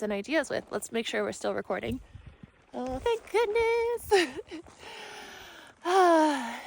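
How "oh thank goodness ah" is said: high, slow relieved sigh of words. Real, not acted. Finding the recording still running, high slow relief.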